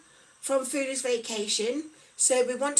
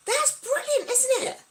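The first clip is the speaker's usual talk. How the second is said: delighted; 12 semitones higher than usual. Real, not acted. Pleased at improved blood results.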